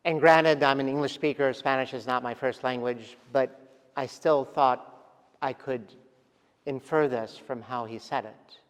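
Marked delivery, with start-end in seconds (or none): none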